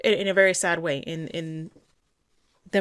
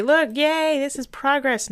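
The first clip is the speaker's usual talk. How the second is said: much higher, louder fake cheer; mocking the owner's fake excitement over progress; 9 semitones higher, 6 dB louder, slower than usual. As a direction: sarcastically chirp the owner's proud yay. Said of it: "you're gonna say".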